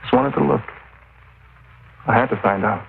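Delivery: high, strained, distraught voice; broken confession. Acted. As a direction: crack as he confesses. Killer breaks down, confesses.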